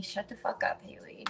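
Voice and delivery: hushed self-scolding whisper; quick whispered aside to herself. Real, not acted. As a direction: mutter it under your breath to yourself.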